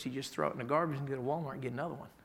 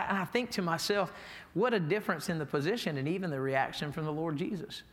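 ordinary delivery

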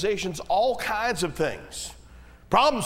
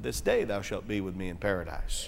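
hushed solemn quote; 10 semitones lower, 6 dB quieter than usual. Retold or imitated. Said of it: "He said".

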